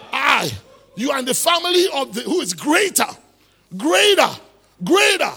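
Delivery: loud, high preacher's climax; rousing pulpit shout; full-force preaching. Real, not acted. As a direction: shout it to the congregation, building on each "greater".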